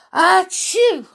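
high, loud storybook sneeze; acted sneeze. A narrator's reading. Sneezy looks up at the castle, acted sneeze. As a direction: burst out a pretend "achoo". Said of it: "he sneezed".

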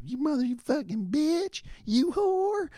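very high, shrill-sounding mock screech; screaming sister. Sister attacking her brother's wife, high mock screech. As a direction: screech insults like a furious sister.

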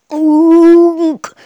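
high, loud creature croak; sudden eerie unk from the dark; monster call. Acted. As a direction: croak it out like a lurking night monster. Night walker calls nearby.

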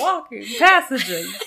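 slow, laughing pun on passages; laughing mock. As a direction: tease the words while laughing.

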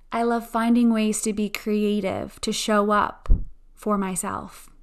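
slow, calm affirmation voice; guided affirmations. Acted. Guided affirmation and breathing meditation.

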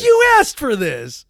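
high, loud, drawn-out complaint; hammed-up indignation; mock exasperated protest. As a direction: wail it like a wronged parent. Echoes parent's protest, joking.

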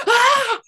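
high startled shriek; startled scream. Retold or imitated. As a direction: shriek in surprise. Phone rings, all scream.

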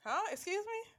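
high, startled excuse me; mock shock. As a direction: act taken aback. Retold shocked reaction.

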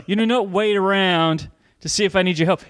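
ordinary talk

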